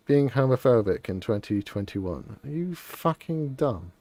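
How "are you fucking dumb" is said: ordinary talk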